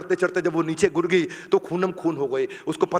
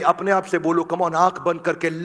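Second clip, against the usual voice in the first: ordinary delivery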